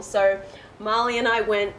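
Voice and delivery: usual speaking voice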